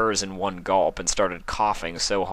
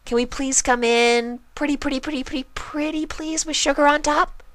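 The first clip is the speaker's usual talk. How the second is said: very high, sing-song begging; Pinkie Pie pleading; 3 dB louder than usual. A narrator's reading. Pinkie begging Spike at the door, very high sing-song. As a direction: plead like an eager pony.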